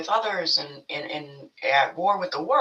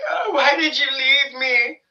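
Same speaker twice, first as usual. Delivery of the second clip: high mock sob, louder; mock wailing; 7 semitones higher, 5 dB louder than usual. Mocking someone crying over an ex's body, high wail. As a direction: ham up a grieving plea over the ex. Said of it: "Being like".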